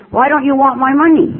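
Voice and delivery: high, loud mock mother voice; mimicking mother. Mother voiced calling back.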